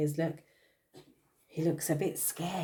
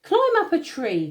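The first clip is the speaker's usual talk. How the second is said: high, louder call up from the treetops; calling monkey; 11 semitones higher, 8 dB louder than usual. Acted. Monkey calling to the animals below from the treetops, high call. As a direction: shout the warning down from the branches. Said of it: "called the monkey from the treetops".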